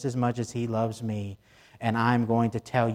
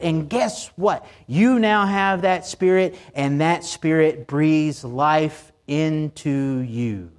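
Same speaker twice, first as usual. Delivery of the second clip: higher and louder, fervent sermon climax; full-force preaching; 5 semitones higher, 4 dB louder than usual. Real, not acted. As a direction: preach it out with rising fervor.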